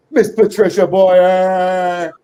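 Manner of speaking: slow, loud showman introduction; grand announcement. Acted. Host announcing the guest to the audience, booming and drawn out. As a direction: announce her like a star entering.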